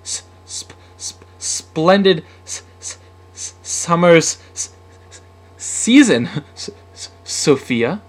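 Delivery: whispered false starts, words bursting out loud; badly tongue-tied, hissing stutter; shocking stutter. A narrator's reading. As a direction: stammer on every first sound. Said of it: "Sam stuttered shockingly".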